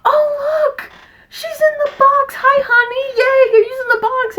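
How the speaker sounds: very high, loud excited squeal; high-pitched thrilled cry at the cat in the box. Retold or imitated. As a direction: squeal with delight at the find. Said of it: "I'm like".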